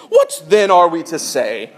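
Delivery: put-on prim British royal accent, lightly done; overly proper British royal. Pastor mocking the translation as a British royal, proper accent. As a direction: lift the question like a stuffy British royal. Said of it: "Paul sounds like a overly proper British royal".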